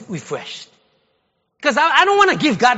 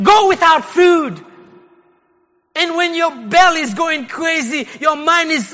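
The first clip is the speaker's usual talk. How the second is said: usual speaking voice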